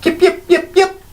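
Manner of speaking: high, tiny kobold yips; yipping kobolds. Acted. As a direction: yap like a pack of little kobolds.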